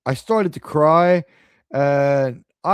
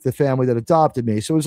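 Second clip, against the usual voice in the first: ordinary talk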